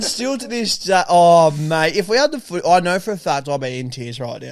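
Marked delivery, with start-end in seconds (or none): none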